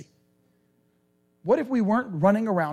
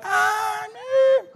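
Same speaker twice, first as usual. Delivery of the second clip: mock dismay; 12 semitones higher than usual. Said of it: "we're like".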